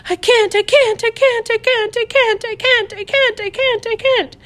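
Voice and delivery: spiral up into panic; rising panic. A narrator's reading. Terrified girl repeating, louder now.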